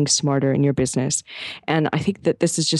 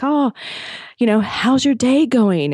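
slow, put-on English accent, a bit higher; 8 semitones higher than usual. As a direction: mimic a cheery English hotel clerk, drawn out. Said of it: "I can't do a proper English accent".

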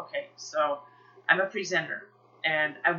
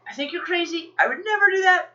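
high-pitched, emphatic disbelief; imitated husband balking, pitched way up; incredulous husband; 11 semitones higher, 7 dB louder, faster than usual. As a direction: exclaim it as an astonished aside. Husband's aside, acted.

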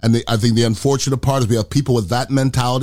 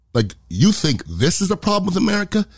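usual speaking voice

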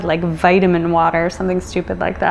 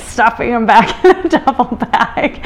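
higher, words breaking into laughter; laughing while talking; 5 semitones higher than usual. Real, not acted. Laughs recalling the cat's escape.

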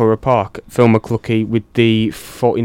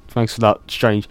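ordinary talk

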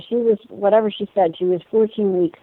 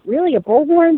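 incredulous question; 9 semitones higher, 3 dB louder than usual. Retold or imitated. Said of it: "I questioned her".

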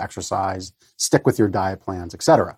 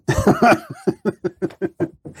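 high laugh. Real, not acted. Laughing after coughing fit.

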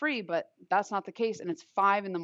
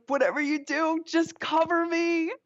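panicked plea; 10 semitones higher, 3 dB louder than usual. Retold or imitated. On the phone to Chelsea as mom arrives, a high desperate plea.

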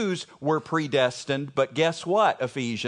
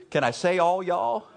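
usual speaking voice

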